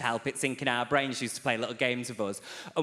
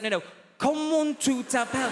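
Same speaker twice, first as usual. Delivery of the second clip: much higher, pleading French question; exasperated professor; 10 semitones higher than usual. Retold or imitated. Professor begging the student to understand, much higher. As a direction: plead the question, near despair.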